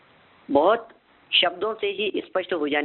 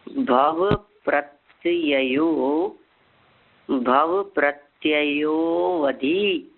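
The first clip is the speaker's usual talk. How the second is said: drawn-out syllable by syllable; very slow recitation; much slower than usual. Shown as a demonstration. Teacher reciting slowly so students can repeat. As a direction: spell the sutra out slowly for students. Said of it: "देखिए बहुत धीरे धीरे बोलता हूँ".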